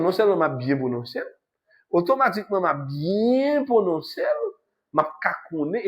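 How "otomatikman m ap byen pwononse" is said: usual speaking voice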